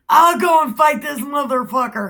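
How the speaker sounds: loud, high comic battle boast; mock bravado. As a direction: burst out as a hammed-up eager fighter. David boasts to Saul.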